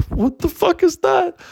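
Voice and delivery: very high, squeaky crying voice; hammed-up high wail; tearful patient. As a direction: blurt it through mock sobs. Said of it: "And she's like".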